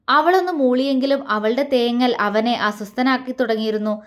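ordinary delivery

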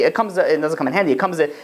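ordinary delivery

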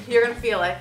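normal talking voice

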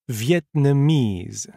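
Vietnamese spelled out slowly, NESE leaned on; slow teacher's stress demo; very slow stressed syllables. Shown as a demonstration. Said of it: "faites attention aux syllabes accentuées".

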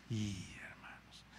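sudden breathy aside; whisper. Real, not acted. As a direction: drop to a hushed whisper to the congregation. Preacher drops to whisper.

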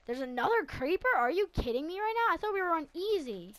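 high, loud disbelief; exasperated outburst. Real, not acted. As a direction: shout it in frustrated disbelief. Exasperated at another creeper.

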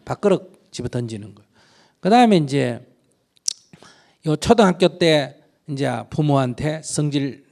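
normal talking voice

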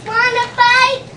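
loud, high, picking a fight; grouchy ladybug. Acted. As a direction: shout it out like a grouchy bug. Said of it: "said the grouchy ladybug".

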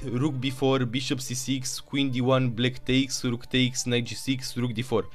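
ordinary talk

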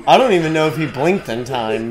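ordinary talk, nothing marked

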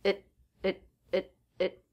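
soft, quick "it" with a held T; reduced at, stop T. Shown as a demonstration. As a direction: clip the T short and quiet. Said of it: "so the T here is a stop T".

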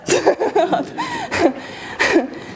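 bursts into a genuine laugh at the answer; real laugh. Real, not acted. Teacher laughing at a student's joke answer, real laugh. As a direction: let a real laugh break out.

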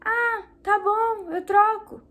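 high, very slow, meek giving in; mock pushover voice. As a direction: play your old softie self caving to the customer. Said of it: "Antes eu era muito mole". (imitando a si mesma mole, bem devagar e aguda)

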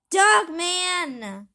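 loud yell for Dogman; big loud call. Acted. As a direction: shout his name to get him. Calling for Dogman.

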